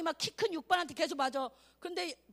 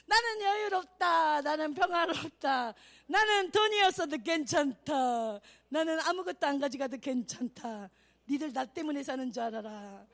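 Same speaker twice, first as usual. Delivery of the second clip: playful serene boast; 4 dB louder than usual. Acted. Acts Daniel's serene boast to king. (느리게 늘이며 여유로운 척 선언)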